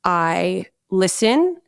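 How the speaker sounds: ordinary delivery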